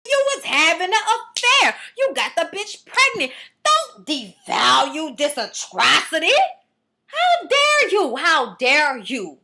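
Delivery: high, loud, slow mock outrage; outraged Mimi. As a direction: ham up a furious wife, stretching every word. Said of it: "And Mimi was like".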